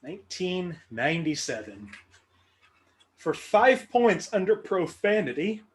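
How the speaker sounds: ordinary talk, nothing marked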